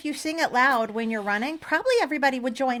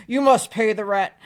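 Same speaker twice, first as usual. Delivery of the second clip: slow, loud villain demand; mustache twirling villain; 2 semitones lower, 6 dB louder than usual. Pretend. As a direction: boom it out like a melodrama landlord. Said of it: "anytime I hear vaudeville".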